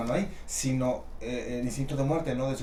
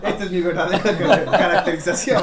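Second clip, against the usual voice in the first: laughing; 3 semitones higher, 10 dB louder, much faster than usual. Real, not acted. Broma de disfraz, riendo.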